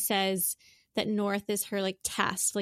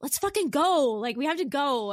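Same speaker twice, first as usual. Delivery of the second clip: retold exasperated outburst, higher and louder at first; impatient outburst; 6 semitones higher, 3 dB louder than usual. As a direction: burst out like a parent out of patience. Impatient parent, child zipping slowly.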